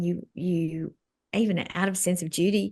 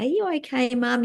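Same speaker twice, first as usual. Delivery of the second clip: son's question; 6 semitones higher than usual. Retold or imitated. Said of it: "He would say".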